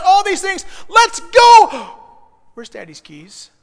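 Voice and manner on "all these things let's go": high, loud hurry-up shout; rushed shout. Retold or imitated. Father hurrying kids out the door: loud, high impatient shout. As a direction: yell at the family to get moving.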